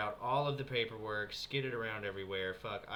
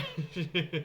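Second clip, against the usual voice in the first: self-mocking laugh; 5 semitones higher, 5 dB louder than usual. Real, not acted. Laughs at own martyr pose.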